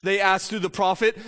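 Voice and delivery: usual speaking voice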